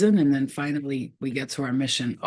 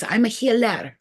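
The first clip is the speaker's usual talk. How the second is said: higher, strong proud declaration; powerful healer; 6 semitones higher, 3 dB louder than usual. Retold or imitated. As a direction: proclaim it with a healer's strength. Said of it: "that's how she talks".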